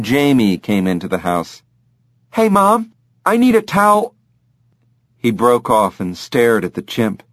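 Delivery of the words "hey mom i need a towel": put-on higher boyish voice; young boy. Jamie comes home calling to mom, higher boyish voice.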